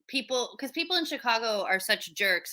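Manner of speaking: ordinary talk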